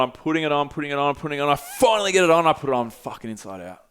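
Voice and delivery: ordinary delivery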